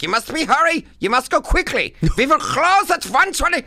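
put-on loud, high-pitched German accent. German lunch lady ordering the comic to hurry, strict German accent. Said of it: "like, a German accent".

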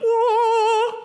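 high amazed whoa; mock whoa. Pastor imitating the disciples' awe on the mountain, amazed.

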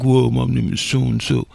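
low mock manly voice, spoken out; mocking deep voice. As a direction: drop it low like a man. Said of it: "She sounds like a guy, like a man".

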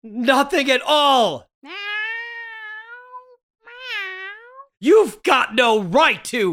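panicked shout. Acted. Man shouts the policeman has no right to look, frantic. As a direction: cover the secret, shouting.

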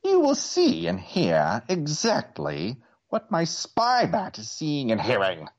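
high sneering villain voice; gloating evil overlord; Skeletor voice. A narrator's reading. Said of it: "cried Skeletor".

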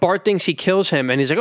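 ordinary delivery

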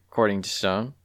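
ordinary delivery

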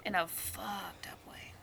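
quick hushed aside; whispering. Real, not acted. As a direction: whisper it like a secret.

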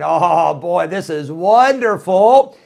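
loud, slow, savouring delight; child's mock bliss. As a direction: relish the stolen cookie. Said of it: "you are thinking".

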